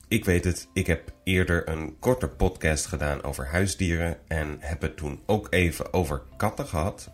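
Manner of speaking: usual speaking voice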